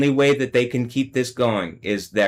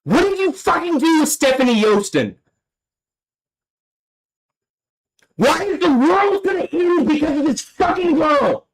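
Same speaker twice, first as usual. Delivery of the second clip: much higher, strained, steady fury; raging demands. Real, not acted. Calling down doom in Yahweh's name, furious and much higher. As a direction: demand it at the top of the voice. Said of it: "fuck, I'm angry".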